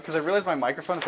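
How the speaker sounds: ordinary talk, nothing marked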